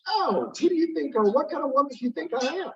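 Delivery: hammed-up offended lady voice; mock-offended Lady Astor. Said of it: "He goes".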